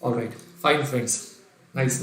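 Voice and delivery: ordinary talk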